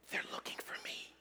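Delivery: put-on hushed child's whisper; whispering little boy. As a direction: whisper it like a small boy hiding. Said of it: "He was whispering".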